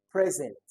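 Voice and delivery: "present" as PREZ-ent, loud; noun stress. Shown as a demonstration. Teacher returns to the noun, "present" stressed on the first syllable. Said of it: "that's a noun".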